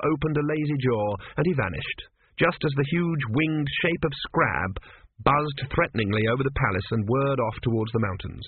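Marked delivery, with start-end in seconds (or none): none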